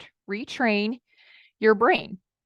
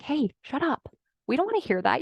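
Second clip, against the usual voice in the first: higher-pitched mock telling-off; mock scolding; 5 semitones higher, 3 dB quieter than usual. Coach acting out scolding her inner child, mock scolding. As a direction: shush a little child, lightly acted.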